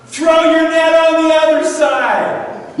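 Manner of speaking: high, loud, slow shout; calling out. Retold or imitated. Man on shore instructing fishermen, shouted. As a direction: stretch each word to carry across water.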